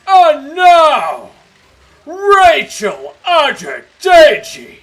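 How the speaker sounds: huge, high, stretched-out stage announcement; X Factor announcer. Acted. Bringing a contestant on stage, booming X Factor announcer voice. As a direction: bellow it like a hammed-up TV announcer. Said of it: "get into his voice".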